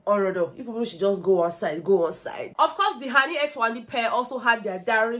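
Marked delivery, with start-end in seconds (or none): none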